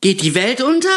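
jolted awake, cry out in alarm; frightened shriek. A narrator's reading. Startled woman at the window, cries out in fright. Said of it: "erschrocken das Fenster öffnete".

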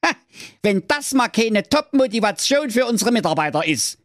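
high, put-on gleeful old man; high gleeful old-man cry; delighted Wilhelm. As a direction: crow with pleasure at the idea. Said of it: "rief der Wilhelm erfreut".

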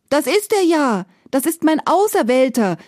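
high, loud joyful exclaim; excited cry. Acted. Princess recognising Iwan, joyfully crying out. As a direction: cry out with delight at finding him. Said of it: "rief die Zarewna".

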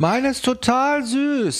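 high, slow sugary dog owner; sweet dog owner. Retold or imitated. The other dog owner reassures the hosts, gushing high and slow. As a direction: gush like a doting dog lady.

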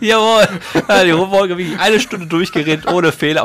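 loud, high-pitched exclamation after laughing; amused outburst. Real, not acted. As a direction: burst out, loud and high, over the blooper. Outburst after laughing at closing blooper. (laut und aufgekratzt nach dem Lachen)

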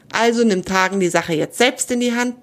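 ordinary delivery

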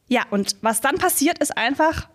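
ordinary talk, nothing marked

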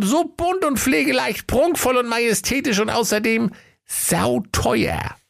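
very high, gushing sales patter; fawning shopkeeper. Acted. Höke sells wondrous clothes to Kaiser, high and gushing. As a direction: rhapsodise over the clothes in a high comic voice.

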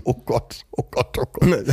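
giggling through the words; laughing helplessly while speaking. Real, not acted. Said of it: "zum Lachen bringen".

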